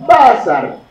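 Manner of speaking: Basar with BA high and loud; stress on first syllable. Shown as a demonstration. Teacher giving an exception example, first syllable stressed. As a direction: hit the BA of Basar, then fall. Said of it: "l'accent visible pour que tout le monde".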